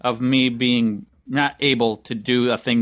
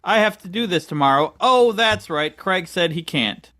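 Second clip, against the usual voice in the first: higher-pitched mock sudden realization; imitating co-host; 7 semitones higher than usual. Co-host imitates his friend reading the calendar, mock realization. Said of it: "and you were like".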